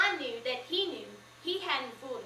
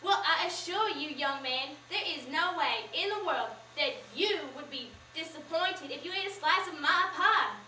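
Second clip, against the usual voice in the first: louder, insistent reassurance; beside herself; 2 semitones higher, 4 dB louder than usual. Acted. Mama assuring the young man her pie won't disappoint, worked up and louder. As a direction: push the claim hard, all worked up. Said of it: "Mama was beside herself".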